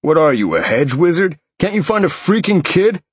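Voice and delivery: irritated. A narrator's reading. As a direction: berate him with mounting irritation.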